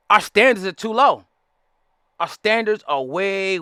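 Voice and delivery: ordinary talk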